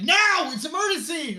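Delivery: very loud, high shout; imitated frantic demand; urgent brother yell. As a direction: yell it like it can't wait. Brother yells urgently in retold story.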